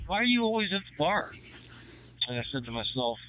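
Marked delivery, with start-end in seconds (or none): 0.1-1.3 high-pitched mock questioning voice; imitating buddy's question. Buddy asking about the bar, high-pitched mock query. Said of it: "He goes".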